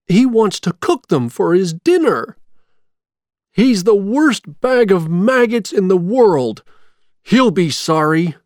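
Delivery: high, loud, heated outburst; indignant alarm. A narrator's reading. Jack to Zero about Oogie's captives, indignant. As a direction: raise the voice in indignation.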